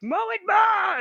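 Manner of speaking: very high, loud mother's yell; over-the-top shouted command; mock maternal shriek. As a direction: shriek the order as an unreasonable mom. Imitates mother insisting louder.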